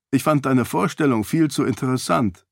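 usual speaking voice

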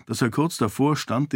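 usual speaking voice

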